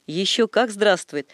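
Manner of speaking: plain reading voice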